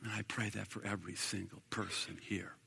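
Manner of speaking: hushed amen-closing; whispered benediction; whispered prayer. Real, not acted. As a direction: breathe the final words. Prayer close.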